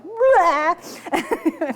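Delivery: high, loud comic wail; hammed-up wordless outburst; mock bawling noise. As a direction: burst out with an exaggerated high bleat. Imitates own hidden reaction, eyes closed.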